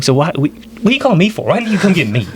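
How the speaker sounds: high-pitched retold exasperation; incredulous challenge. Nephew questioning why uncle called him, incredulous. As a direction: push the questions up high in disbelief.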